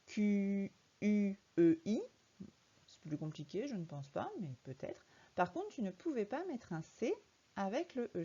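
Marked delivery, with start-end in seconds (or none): 0.1-2.0 Q U E I spelled slowly and loudly, letter by letter. Shown as a demonstration. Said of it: "Tu as peut-être écrit".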